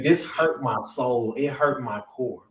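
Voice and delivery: ordinary talk, nothing marked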